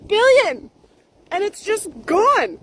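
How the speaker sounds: loud, high disbelieving shout of one word; outraged shout. Real, not acted. Venting to viewers about wasted billions, loud outraged shout. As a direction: blurt it out in outrage.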